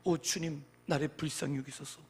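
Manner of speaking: usual speaking voice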